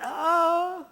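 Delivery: short high gasp of release; light imitated gasp of relief; relieved gasp. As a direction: let out a sudden gasp as pain lifts. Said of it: "she grabbed her head and went".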